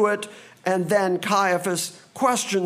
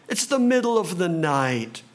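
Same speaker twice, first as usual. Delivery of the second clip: normal talking voice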